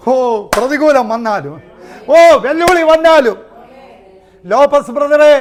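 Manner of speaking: high, loud ecstatic exhortation; preaching at full force. Acted. Preacher encouraging Brother Lopez, loud and high. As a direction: cry out the promise of victory.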